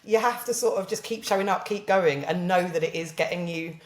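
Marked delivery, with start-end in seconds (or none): none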